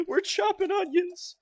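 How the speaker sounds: high, whiny, hammed-up sob voice; mock crying. Streamer mock-weeping to chat over onions, high whiny fake sob. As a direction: play it as a fake tearful whimper.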